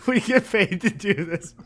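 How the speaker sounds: said high through a laugh; giggling it out. Real, not acted. Teasing the cohost about his burp, laughing.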